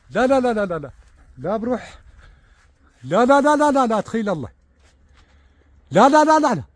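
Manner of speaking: loud, high, desperate repeated no; anxious cries of no. Real, not acted. Watching the chicks on camera, he shouts no in alarm. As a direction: cry out in alarm as the chick falls.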